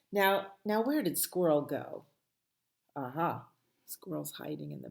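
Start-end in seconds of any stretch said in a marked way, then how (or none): none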